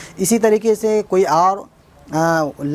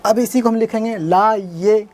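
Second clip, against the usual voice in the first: usual speaking voice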